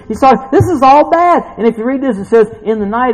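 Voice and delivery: loud, high sermon delivery; fired-up preaching. Real, not acted. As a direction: preach it with rising force. Preaching at full force.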